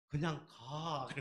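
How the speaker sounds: slow, stretched Chungcheong "just go"; acted Chungcheong driver, unhurried; attempted Chungcheong expression. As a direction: drag out the last word, Chungcheong style. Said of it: "충청도 표현이 잘 안 돼서".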